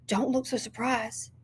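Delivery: soft hiss out of the side of the mouth; a girl hissing a warning to her friend; hissed aside. A narrator's reading. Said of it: "hissed out the side of her mouth".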